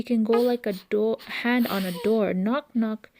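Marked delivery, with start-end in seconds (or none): none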